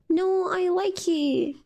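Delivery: put-on stubborn little kid, whispered and slow; whispered child. Child refusing his mother, slow stubborn whisper. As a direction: whisper it like a kid who won't give in.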